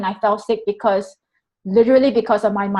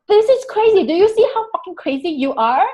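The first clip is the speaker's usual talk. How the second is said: exasperated; 6 semitones higher than usual. Retold or imitated. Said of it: "everything was happening in myself".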